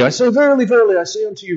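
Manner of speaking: playful mock-solemn King James; hammed-up King James voice. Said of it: "We don't speak like that anymore".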